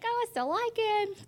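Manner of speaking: mock lovesick. Quoting her younger lovestruck self.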